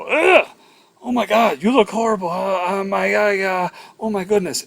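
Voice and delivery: hammed-up panic with stammering uhs; panicked shock. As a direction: recoil in alarm at how bad they look. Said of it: "The worst thing you can do is just go".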